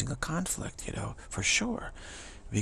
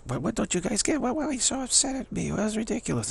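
much higher, whiny bafflement; mock innocent shtick; 12 semitones higher than usual. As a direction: play wounded innocence, high. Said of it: "he's doing this shtick".

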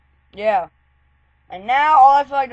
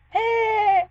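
put-on girl's distant call; 12 semitones higher than usual. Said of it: "We heard".

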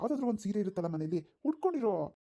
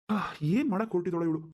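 ordinary talk

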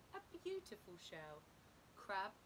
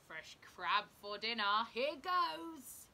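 hungry fish; 9 dB louder, slower than usual. A narrator's reading. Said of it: "fish about to bite".

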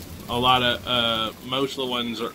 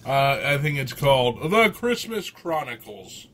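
ordinary delivery

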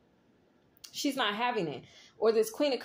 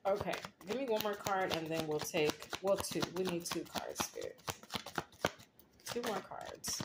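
muttering to self; 2 semitones lower, 7 dB quieter than usual. Real, not acted. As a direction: talk softly to yourself, half to Spirit. Muttering to self while drawing cards.

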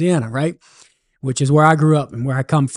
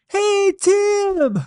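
much higher Cajun voice calling across a field; Cajun holler. Acted. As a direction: call out high in a folksy Cajun accent. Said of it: "And he hollered out".

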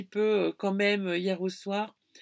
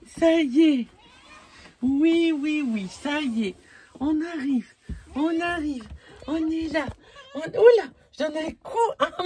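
voice to the dog; 7 semitones higher, 3 dB louder than usual. Real, not acted. Talks to her dog Cassie.

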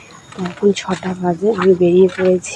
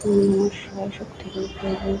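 softer, murmured devotional chant; murmured prayer; 13 dB quieter than usual. Real, not acted. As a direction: say a quiet prayer to Shiva. Devotional chant after temple worship.

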